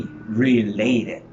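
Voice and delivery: "related" slowly with long LAY; stress on second syllable. Shown as a demonstration. Teacher confirming the stressed syllable, long LAY in "related". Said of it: "Which one is the stressed syllable?".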